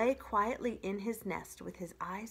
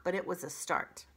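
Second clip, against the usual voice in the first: ordinary delivery, nothing marked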